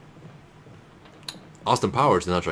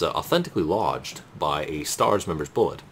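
usual speaking voice

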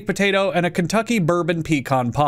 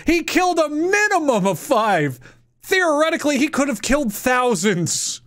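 outraged rant; 6 semitones higher than usual. Real, not acted. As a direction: erupt in disbelief at the killer. Outraged rant about a killer.

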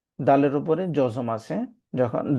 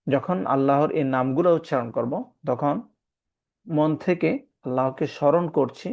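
ordinary talk, nothing marked

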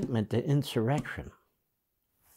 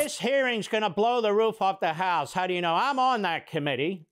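higher-pitched mock boasting; mocking impersonation; 7 semitones higher than usual. Host parodies congressman promising explosive hearings, mocking. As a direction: puff it up in a high mocking voice.

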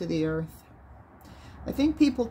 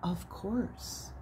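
confident reassurance; 9 dB quieter than usual. Retold or imitated. Host reassures the calmed caller, much softer. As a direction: reassure her quietly, sure of yourself. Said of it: "in all his earthly confidence, said".

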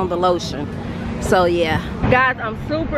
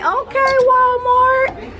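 much higher cheering hype call; 14 semitones higher, much slower than usual. Retold or imitated. Vlogger voicing what people say to Walmart, much higher hype cheer. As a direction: cheer the store on like a crowd. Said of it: "What they say?".

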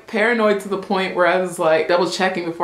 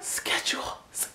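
schedule whispered as sk-edule; 13 dB quieter than usual. Shown as a demonstration. Coaching viewers in a whisper. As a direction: breathe out schedule. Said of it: "try it with me now".

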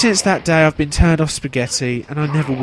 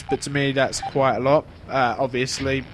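ordinary delivery

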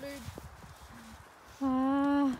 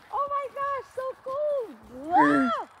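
shocked by the cold; 10 semitones higher than usual. Real, not acted. Reacting to cold snow.

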